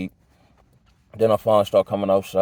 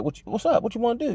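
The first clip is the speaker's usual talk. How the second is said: higher, goading challenge; picking a fight; 12 semitones higher, faster than usual. Shown as a demonstration. Dude daring the narrator to fight, goading and confrontational. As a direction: push him to step up. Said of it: "that's more like".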